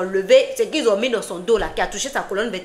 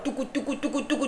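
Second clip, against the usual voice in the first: quiet rhythmic gulping, tout coup tout coup; hushed sound of someone gulping drink after drink; soft gulping chant; 3 semitones higher, 8 dB quieter than usual. Retold or imitated. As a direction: murmur it much softer, like gulps going down. Mimicking gulps of drink at wedding.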